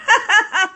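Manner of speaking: high, giggly burst of laughter. Real, not acted. Coach catching herself getting excited, laughing. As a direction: laugh at yourself for getting carried away.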